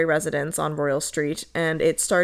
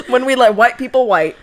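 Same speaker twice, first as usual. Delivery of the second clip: louder, higher laughing delivery; 6 semitones higher, 4 dB louder than usual. Real, not acted. Host cracking up at her own joke, laughing. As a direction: laughs through the line.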